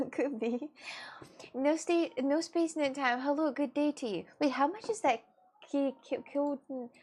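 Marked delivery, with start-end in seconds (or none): none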